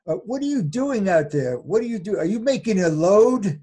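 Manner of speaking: high, loud acted holler to someone outside; impatient calling out. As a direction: call out as an exasperated storybook werewolf. Impatient werewolf calls out in story.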